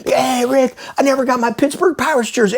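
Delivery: high-pitched holler across a room; imitated calling out. Listener spotting the host around town, high mock complaint. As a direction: mimic a complaining fan hailing someone.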